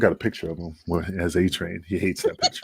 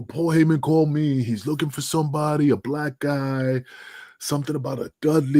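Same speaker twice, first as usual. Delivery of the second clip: higher-pitched retelling of Johnny, loose and chatty; retold Johnny pitch; 6 semitones higher than usual. Johnny pitching Adrian on ECW, higher and chatty. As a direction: lift the voice to play Johnny asking Adrian.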